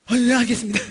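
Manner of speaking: very loud snap-to reply; imitated obedient drunk. Cowed drunk man answering the preacher, loudly obedient. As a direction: answer like a recruit obeying orders. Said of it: "얘가 이러더래".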